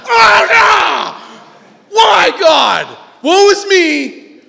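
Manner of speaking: high, loud hammed-up wailing, slow on the end; mock despair. A pastor mocking a despairing convert, loud high wail. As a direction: overplay the woe, shouting. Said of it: "losing their mind".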